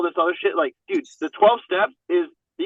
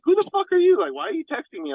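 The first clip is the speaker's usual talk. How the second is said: mock-incredulous, pitched way up; higher, baffled retort; 13 semitones higher than usual. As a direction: fire it back like a suspicious newcomer. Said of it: "And I'm like".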